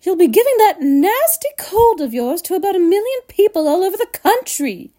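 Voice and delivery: high, scolding mother; indignant high-pitched telling-off; shouting. A narrator's reading. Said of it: "shouted Missus Gloop".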